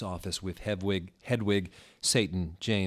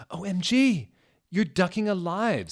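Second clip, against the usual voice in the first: excited squeal; 11 semitones higher, 7 dB louder than usual. Acted. Ginny squealing at reunion.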